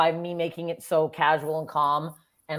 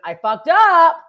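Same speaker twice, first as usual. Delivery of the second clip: high, loud rallying call; retold crowd announcement; 8 semitones higher, 7 dB louder than usual. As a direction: own the blunder boldly to a crowd. Retells announcing mistake to crowd.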